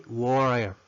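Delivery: WATER said "warer", tapped R for T; water with R sound. Shown as a demonstration. Teacher confirming the option, water with R for T. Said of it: "Podemos usar la R".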